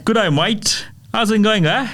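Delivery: high, bright Aussie greeting, hammed up; doing Australian friends, hammed up; Australian accent. Shown as a demonstration. Host shows guest the Australian accent, playful Aussie voice. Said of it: "my Australian friends".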